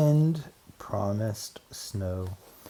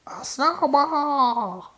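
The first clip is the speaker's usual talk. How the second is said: very high, slow gleeful cry; little mice echoing in glee; 5 dB louder, much slower than usual. Acted. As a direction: squeal it with delight like tiny mice. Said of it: "echoed the little mice gleefully".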